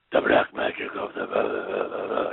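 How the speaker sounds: low, whispery mock villain voice. Mimicking the masked villain's magic act on stage, evil and mysterious. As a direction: imitate an evil magician, trailing into low gibberish.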